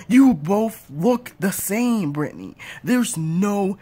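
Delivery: dragged-out, emphatic insistence; slow insisting. Acted. Parent insisting they look the same, slow. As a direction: stretch every word to insist.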